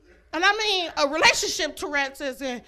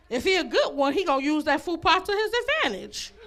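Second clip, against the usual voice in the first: normal talking voice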